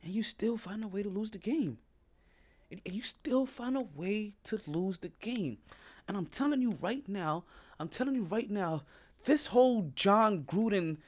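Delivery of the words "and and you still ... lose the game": whispered slowly, for effect; slow, breathy aside to the audience. Shown as a demonstration. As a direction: drop to a slow hush to sink the point in. Repeated line, second take whispered.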